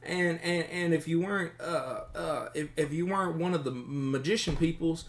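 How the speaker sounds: high, hurried, stammering voice; nervous captive bandit. Acted. Captive bandit recalls what his friend said, high and flustered. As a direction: blurt it out with false starts.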